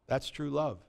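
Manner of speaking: soft. Shown as a demonstration. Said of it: "repeated softer".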